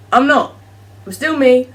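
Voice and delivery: loud, emphatic denial; firm rebuttal. Real, not acted. Insisting to viewers she hasn't changed, loud and emphatic. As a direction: set them straight, loud and firm.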